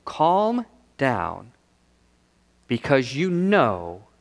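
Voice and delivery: low, slow, calm reassurance; Jesus. Retold or imitated. Preacher voicing Jesus comforting his followers, calm and low.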